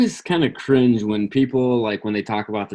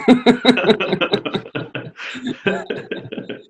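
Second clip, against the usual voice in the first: real laugh, much higher; 11 semitones higher than usual. Real, not acted. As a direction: burst out laughing. Laughing at colleague's joke.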